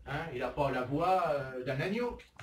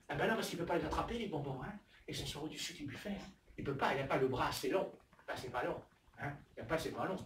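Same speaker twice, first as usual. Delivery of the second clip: ordinary delivery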